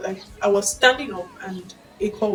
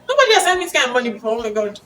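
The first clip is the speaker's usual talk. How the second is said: overjoyed; 3 semitones higher, 6 dB louder, much faster than usual. Retold or imitated. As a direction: blurt it out fast as if the money just landed. Money alert arrives, overjoyed.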